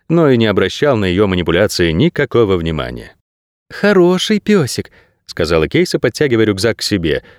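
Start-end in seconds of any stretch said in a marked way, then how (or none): 3.7-4.9 slow, high doggie-coaxing voice; affectionate. A narrator's reading. Girl pulling her rucksack away from the dog, affectionate. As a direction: draw it out, soothing a dog. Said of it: "приговаривая ласковым голосом".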